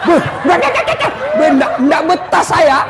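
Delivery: a jinn stammering in fright; panicked jinn. Retold or imitated. Jinn fleeing the nagging wife, high panicky stammer.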